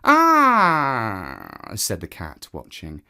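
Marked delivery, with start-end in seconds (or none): 0.0-1.7 proud cat voice, higher and slow; the smug cat claiming his place. Acted. Cat boasting to the woman by the fire, higher and slow.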